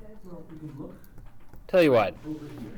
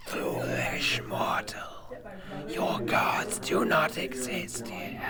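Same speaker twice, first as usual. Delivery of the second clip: slow, breathless whisper from many serpents; menacing snakes whisper their taunt; whispering snake swarm; 3 semitones higher, 8 dB quieter, slower than usual. Acted. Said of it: "and they say".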